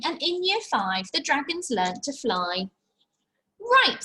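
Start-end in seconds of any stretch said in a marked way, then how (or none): 3.6-4.0 high, loud announcing Right. Acted. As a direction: call the class to attention. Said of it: "said Madam Dragon".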